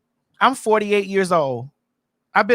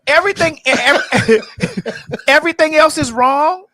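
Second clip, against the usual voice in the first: louder and higher, laughing mid-rant; exasperated outburst; 5 semitones higher, 6 dB louder than usual. Real, not acted. Speaker ranting at hypocrites, louder and laughing. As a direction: burst out, laughing in disbelief.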